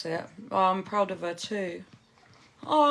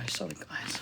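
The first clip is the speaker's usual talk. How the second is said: hushed whisper; soft aside to viewers, unvoiced; 9 dB quieter than usual. Real, not acted. Whispering near sleeping baby.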